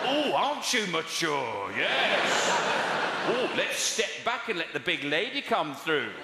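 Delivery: sarcastic heckle. Pretend. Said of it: "I was gonna say".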